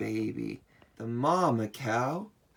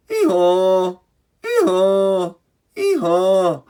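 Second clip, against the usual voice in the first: loud, high ee-haw braying; donkey bray; 7 semitones higher, 9 dB louder than usual. Pretend. Showing kids the donkey's call, loud braying. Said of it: "The donkey goes".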